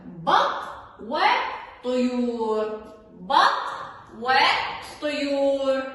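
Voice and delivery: very slow, loud بط وطيور; announcing the lesson title to children. Shown as a demonstration. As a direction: spell out the title slowly. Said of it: "درسنا اليوم".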